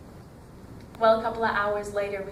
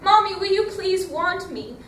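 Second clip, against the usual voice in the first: high, loud pleading call to Mommy; crying out; 7 semitones higher, 8 dB louder than usual. Retold or imitated. Girl pleading with the heavens for her mother, crying out loud. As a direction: beg the heavens for your mother, full voice. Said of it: "She cried out".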